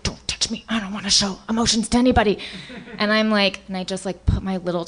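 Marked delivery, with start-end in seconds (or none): 0.0-2.4 put-on whispered sulky boy. Voicing the boy pushing her away.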